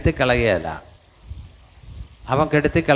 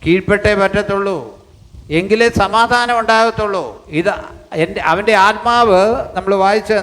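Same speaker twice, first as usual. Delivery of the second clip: fervent preaching; 5 semitones higher than usual. Acted. Pastor urging believers to submit, fervent preaching. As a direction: drive the point home from the pulpit.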